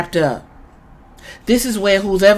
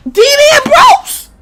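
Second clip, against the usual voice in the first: high, loud thrilled yell of the name; excited shout; 5 dB louder, slower than usual. Retold or imitated. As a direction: shout it like your hero just showed up. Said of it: "I'm like".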